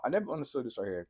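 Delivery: usual speaking voice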